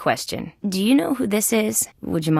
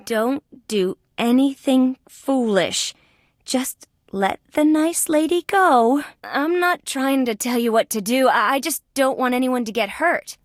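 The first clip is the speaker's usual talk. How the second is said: careful pleading; 6 semitones higher than usual. Acted. Detective begging gunman to free hostage, careful high plea. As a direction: coax gently, pitch raised.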